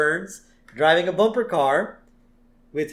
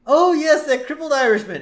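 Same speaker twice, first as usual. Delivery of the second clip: high, loud cartoon old villain; delighted sudden recollection, pitched way up; Mr. Burns; 12 semitones higher, 6 dB louder than usual. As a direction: exclaim it as a gleeful old tycoon. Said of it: "then he stops and he says".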